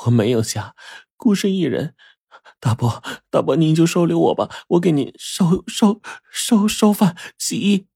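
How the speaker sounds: high, tearful pleading with broken stammers; acted weeping girl, pitched high and faltering; sobbing. A narrator's reading. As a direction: plead through sobs, catching on repeated words. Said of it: "随后就啜泣起来".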